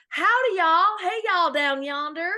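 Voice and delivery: loud, high mock Southern drawl; exaggerated Southern US greeting; Southern accent. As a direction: ham up a folksy American Southern accent. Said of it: "That's our Southern accent".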